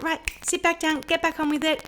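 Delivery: lightly acted teacher rounding up pupils; imitated teacher's command. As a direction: call the class back to work. Teacher calling class back to work.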